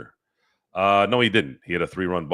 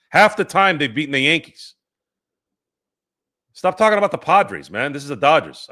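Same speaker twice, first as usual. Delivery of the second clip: much higher and louder, fed up; loud exasperated rebuttal; 7 semitones higher, 7 dB louder than usual. Real, not acted. Streamer snapping at chat over the Padres, loud and exasperated. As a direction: shout down the Padres comparison, exasperated. Defending Dodgers against chat.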